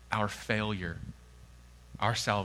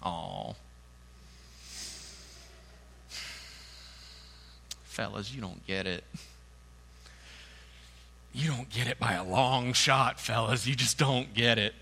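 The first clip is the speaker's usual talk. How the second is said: pitying Jesus. Retold or imitated. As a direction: let them down gently. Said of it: "Jesus just kind of looking at them".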